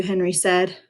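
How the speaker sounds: plain reading voice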